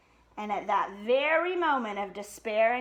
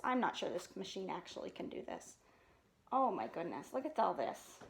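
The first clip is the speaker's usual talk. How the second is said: hushed aside; 4 semitones lower, 10 dB quieter than usual. Real, not acted. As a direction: murmur in amazement.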